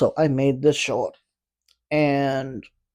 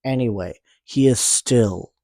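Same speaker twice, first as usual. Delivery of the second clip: ordinary talk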